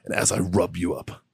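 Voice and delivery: mock a creep murmuring sweet nothings; imitating a creepy seducer, low and hushed; creepy seductive whisper. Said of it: "He goes".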